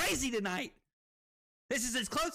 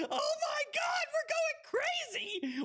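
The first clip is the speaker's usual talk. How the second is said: ecstatic yell. Real, not acted. Streamer celebrating a big win with chat, ecstatic yell. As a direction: scream it like you just won.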